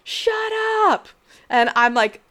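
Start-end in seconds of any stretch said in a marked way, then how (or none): 0.0-1.1 sharp high yell across the house; exhausted husband yelling at a crying baby; yelled shut up. Retold or imitated. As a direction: yell it from the next room, fed up. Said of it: "my husband yells from our bedroom".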